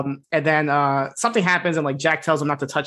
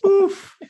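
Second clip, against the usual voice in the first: high oof; 4 dB louder than usual. Real, not acted. Reacting to the joke.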